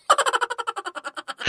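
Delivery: falling run of laughter. Acted. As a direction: laugh in a long descending peal. Friends teasing over hair. (ಜೋರಾದ ನಗು)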